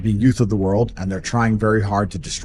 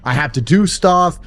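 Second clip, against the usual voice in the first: louder, higher, driving every line home; raised voice, pushing hard; loud rant; 7 semitones higher, 6 dB louder than usual. Real, not acted. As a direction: hammer it out like a fired-up rant. Ranting loudly about daily grind.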